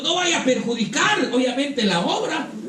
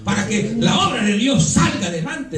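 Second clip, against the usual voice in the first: fiery preaching. Acted. Preacher rising to a climax.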